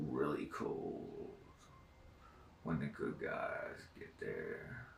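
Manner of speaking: slow, hushed whisper, each word drawn out; meticulous eerie whisper. Retold or imitated. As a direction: imitate a sinister voice whispering very slowly. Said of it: "that's how it sounded".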